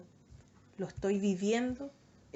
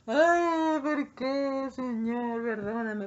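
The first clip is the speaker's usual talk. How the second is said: louder, wailing complaint to the Lord; mock crying lament; 3 semitones higher, 8 dB louder, much slower than usual. Sinner complaining to the Lord after falling, mock wail. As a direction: whine it like a sorry child. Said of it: "Y después andamos llorando".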